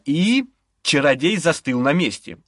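ordinary delivery

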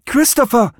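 high, urgent call. A narrator's reading. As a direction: call the name to break through to them. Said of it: "rief Serenity".

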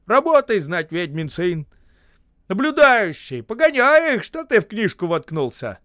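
bellowing at the crew, high and loud; angry yell. A narrator's reading. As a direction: roar orders across the ice. Foreman yells at workers.

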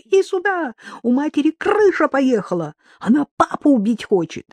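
high, urgent cry for help; alarmed calling. A narrator's reading. Daughter warns brother about their mother, alarmed cry. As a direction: call your brother over, pitched high. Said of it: "закричала дочь".

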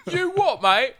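put-on giant Cockney accent, high and loud; hammed-up Cockney yelp; Lena Headey. Mocking an actress off camera, hammed-up Cockney accent. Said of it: "with a giant Cockney accent".

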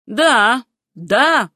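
loud, emphatic repeated yes; forceful, malicious confirmation; beside herself with spite. A narrator's reading. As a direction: hit each yes with jealous anger. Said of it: "совершенно вне себя от злобы и ревности".

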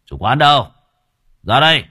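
furious bellow; explosive rage. Acted. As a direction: roar it, slamming the table. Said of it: "hắn đập bàn quát".

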